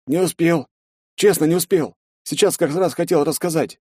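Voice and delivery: put-on a scared young sergeant answering; frightened soldier. A narrator's reading. (испуганный солдат, высоким голосом)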